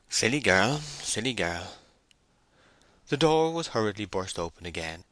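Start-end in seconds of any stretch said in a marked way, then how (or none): 0.1-0.8 put-on louder, exasperated cry of silly girl; exasperated exclamation. Boldwood repeats the phrase. 0.8-1.7 sad murmur. Acted. Boldwood repeats the phrase.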